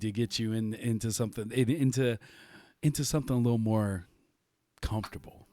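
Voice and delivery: low, hushed, playful innuendo; mock suggestive. Host teasing guest as words sound wrong, mock suggestive. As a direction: sink into a joking come-on, nearly whispering the last word. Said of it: "it was sounding wrong, so I just figured to just take it there".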